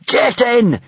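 high, urgent shout. A narrator's reading. Hiccup orders the dragon into the tunnel, shouting. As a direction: shout it as a command. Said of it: "shouted Hiccup".

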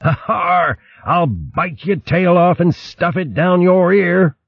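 high gruff pirate bluster; playful threat yelled across water; mock threatening shout. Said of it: "He shouted then".

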